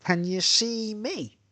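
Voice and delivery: high, slow drawn-out imitation; mimicked woman, high and lingering; imitating ex-girlfriend. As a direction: stretch her question out in a high voice. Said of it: "She says".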